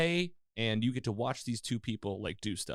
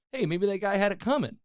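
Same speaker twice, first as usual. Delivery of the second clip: higher, louder acted girl's quote; lightly voiced girl; 9 semitones higher, 5 dB louder than usual. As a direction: lightly play the girl, pitched up. Voicing the girl in retold scene.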